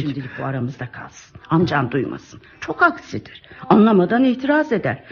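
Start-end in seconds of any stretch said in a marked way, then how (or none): 0.0-2.4 hushed. Acted. Secret kept from the husband.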